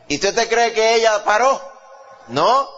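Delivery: high, loud, slowing preacher's climax; fiery preaching. Acted. Preacher stirring the congregation, loud climax. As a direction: drive it home to the congregation.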